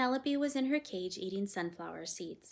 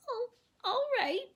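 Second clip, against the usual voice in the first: high, tearful, reluctant give-in; whimpering girl; 6 dB louder than usual. A narrator's reading. Girl reluctantly obeying her guardian, whimpering. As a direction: sniffle it out like a sulky child.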